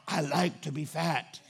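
low, slow comic announcement; mock confession to the world. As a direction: drop low for the punchline. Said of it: "It's like screaming to the world".

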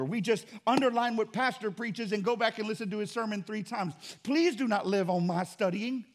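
ordinary talk

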